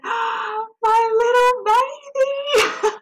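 high, very slow, crying out in wonder; stretched, breaking cry of love; high sobbing exclamation then laugh; tearful joy. Real, not acted. First holding her newborn.

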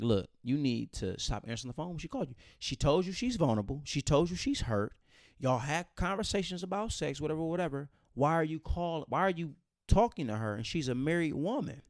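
insistent, rising scolding as a church leader; exasperated telling-off, acted out; retold scolding. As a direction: lecture him with mounting pitch and emphasis.

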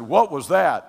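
loud, high, astonished question; stunned shout. Retold or imitated. New believer asks his friend, shouting in amazement. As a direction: blurt it out in shock. Stunned after rushing wind.

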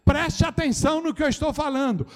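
shouted exhortation to the congregation; preaching at full force. Acted. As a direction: drive the message home like a fiery preacher.

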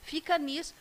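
rapid breathless list, no pause; all in one breath. Real, not acted. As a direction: rattle it off without breathing. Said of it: "Chega a me dar falta de ar".

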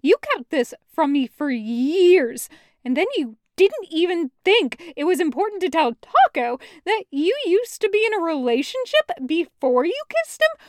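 raging accusation; furious. Acted. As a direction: pour the anger on him. Loop yelling continued.

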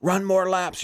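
high, mock coach call; coach's order. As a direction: imitate a coach calling out orders. Acting his coach giving orders.

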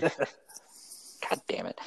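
slow whispered curse under breath; muttered curse. Real, not acted. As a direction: whisper it to yourself, embarrassed. Embarrassed about work computer.

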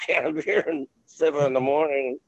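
normal talking voice

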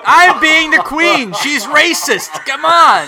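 high, loud comic self-defence; loud protest. Real, not acted. Comedian defending the Queen bit to co-hosts, loud high protest. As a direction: raise your voice and plead the joke.

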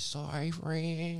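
slow high mocking sorry. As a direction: read her text sarcastically. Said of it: "I get a text message".

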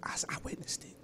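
hushed whispered aside. Real, not acted. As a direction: whisper it under breath.

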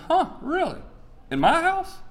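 high-pitched incredulous dad; mock shock. Father hearing his son's confession, mock-shocked. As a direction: act astonished, voice shooting way up.

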